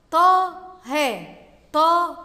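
طه loud, with a long "Taaa" before the h; long alif in طه. Shown as a demonstration. Teacher showing pupils a hidden alif, طه loud and stretched. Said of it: "بعد الطاء ألف تنطق ولا تكتب".

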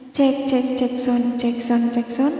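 mic check drenched in echo; full echo. Shown as a demonstration. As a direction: sound check with heavy reverb. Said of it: "ini udah full eho, jadi suaranya kayak gini".

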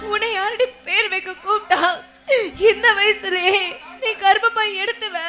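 acted sobbing through hurt words; tearful complaint. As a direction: cry while pleading with a husband. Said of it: "ஏன் அழுவுற?".

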